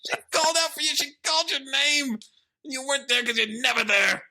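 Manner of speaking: very high, squeaky mock protest; hammed-up squeaking impression of an upset son; Jaden. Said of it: "Just let me do the impression".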